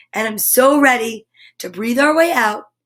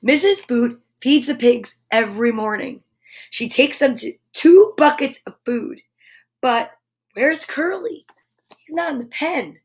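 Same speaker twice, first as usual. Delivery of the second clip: bright, lively storybook read-aloud; animated picture-book narration; reading to children. A narrator's reading. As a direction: read it like story time for little ones. Reads picture book to kids.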